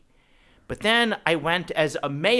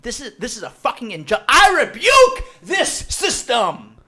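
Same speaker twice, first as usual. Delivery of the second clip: building to a high, loud righteous shout; mock outrage; 8 semitones higher, 9 dB louder than usual. Rant at the bar door building to a big mock shout. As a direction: rant up to a booming declaration. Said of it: "I didn't actually say that".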